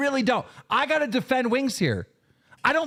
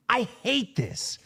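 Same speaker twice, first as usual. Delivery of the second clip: normal talking voice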